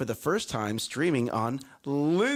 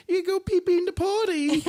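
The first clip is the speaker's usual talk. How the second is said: put-on much higher, louder baby talk; talking to a toddler; 3 dB louder than usual. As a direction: praise a toddler at potty. Mimics praising toddler.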